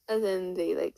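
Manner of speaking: ordinary delivery, nothing marked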